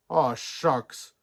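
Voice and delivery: ordinary delivery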